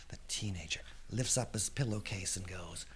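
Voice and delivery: ordinary delivery, nothing marked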